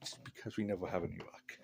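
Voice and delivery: quick whispered joke under the breath; whispered aside. Real, not acted. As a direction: drop to a fast whisper for the punchline. Joking aside about Irish luck.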